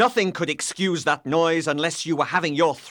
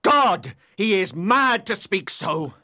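louder, anguished outburst; raging; 8 semitones higher, 5 dB louder, slower than usual. A narrator's reading. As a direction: cry out in rage. Heathcliff raging.

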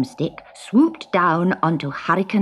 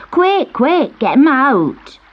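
high, urgent call to hurry; urgent girl; 7 semitones higher, 4 dB louder, slower than usual. A narrator's reading. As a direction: urge the boys to hurry. Girl urging rescue.

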